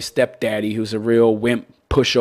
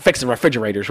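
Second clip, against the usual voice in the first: usual speaking voice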